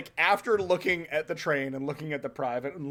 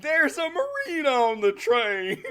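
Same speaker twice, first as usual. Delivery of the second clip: high laughing mock yell; 9 semitones higher, 5 dB louder than usual. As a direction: shout it while laughing. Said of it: "the private yells".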